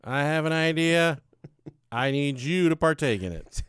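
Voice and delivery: loud, deliberate proposal; hammed-up persuasive pitch; mock pitch to wife. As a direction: propose it to your wife, projecting. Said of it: "Told my wife".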